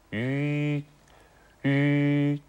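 "い" said through lips puckered for u, high and buzzing; Mandarin ü vowel. Shown as a demonstration. Teacher modelling the hardest vowel, high puckered "い" as ü. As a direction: pucker for u and sustain "い" inside. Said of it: "いを発音しています".

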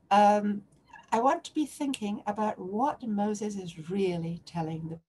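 ordinary talk, nothing marked

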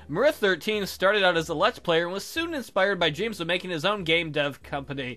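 usual speaking voice

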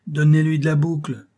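loud, high captain's order; captain driving on his man; forceful command. A narrator's reading. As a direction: call out the order to strike.

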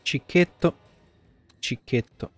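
ordinary talk

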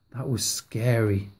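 ordinary delivery, nothing marked

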